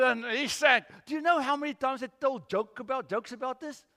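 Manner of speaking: ordinary delivery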